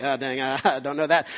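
low, soft, chuckling admission; quiet embarrassed giggle through the words; sheepish. Retold or imitated. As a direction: laugh at yourself being caught out. Mock reaction to spelling red lines.

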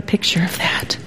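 whispered, awed aside. Real, not acted. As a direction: breathe it out in wonder. Awed aside after hymn quote.

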